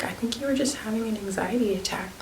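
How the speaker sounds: a quiet, whispered diagnosis to the sister; soft whisper. Retold or imitated. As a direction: gently break it to her in a hush. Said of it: "And I was like".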